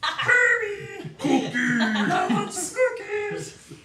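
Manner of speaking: loud, slow cartoon puppet voice; Muppet voice. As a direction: ham it up like a Muppet. Impersonating a Muppet voice.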